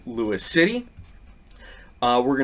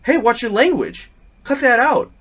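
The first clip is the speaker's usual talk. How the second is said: loud, higher warning; retold stern shout; startling reprimand; 10 semitones higher, 5 dB louder than usual. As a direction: bark a warning to stop swearing. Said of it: "I startled her when I said".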